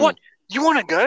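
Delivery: mock-angry challenge, pitched high. Delivery guy squaring up to the blocked-in driver, angry. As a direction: act out a driver squaring up for a fight. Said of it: "he's getting angry".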